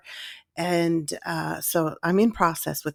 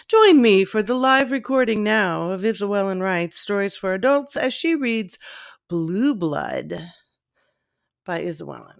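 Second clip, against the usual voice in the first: announcer intro. Acted. Announces her own live recording.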